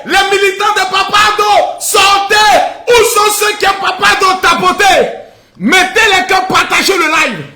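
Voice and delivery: high, loud rallying cries; rally shout. Real, not acted. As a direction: rouse the crowd to share. Rallying viewers to share. (harangue la foule, crié)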